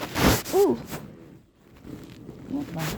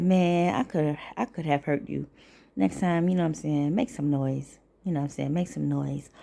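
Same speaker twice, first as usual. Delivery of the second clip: quiet; 5 semitones lower, 6 dB quieter than usual. Retold or imitated. Telling roommate off softly.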